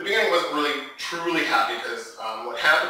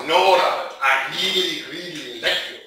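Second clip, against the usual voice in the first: tipsy German plea; 4 dB louder than usual. Retold or imitated. Drunk heartbroken man pleads with a new friend, tipsy insistence. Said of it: "he was kind of drunk".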